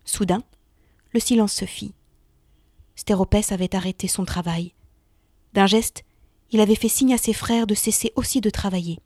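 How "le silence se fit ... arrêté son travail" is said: ordinary delivery, nothing marked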